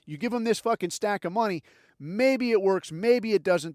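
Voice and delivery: normal talking voice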